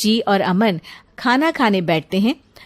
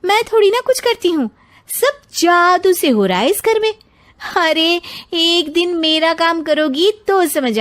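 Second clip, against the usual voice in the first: high, fast mocking sarcasm; sarcastic retort; 7 semitones higher than usual. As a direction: throw it back at her with scorn. (ऊँची, तेज़, ताने भरी आवाज़ में)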